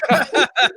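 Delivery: a sudden loud burst of laughter. Real, not acted. Laughing out loud at the Collinsworth quote.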